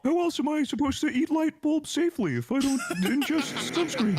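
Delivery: high, silly goober voice. Acted. Goober defending eating sunscreen to friends, silly high voice. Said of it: "anything in that voice is just so goober".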